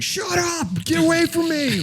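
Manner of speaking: high, loud hammed-up yelling; mock shout. Shouting back at the car radio, mock high yell.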